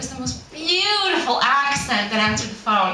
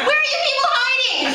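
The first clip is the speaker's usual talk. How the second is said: laughing exasperation; 13 semitones higher, faster than usual. Pretend. Comic exasperation at no Englishmen.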